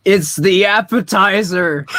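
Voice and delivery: loud, high mock announcer yell; shouted punchline. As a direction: belt the punchline out.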